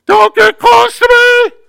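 high, loud panicked yell; terrified shout. Retold or imitated. Preacher voicing a scared believer, high panicked shout. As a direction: shout it out in fear, pushing people away. Frightened person lashing out.

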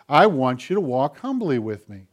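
ordinary delivery

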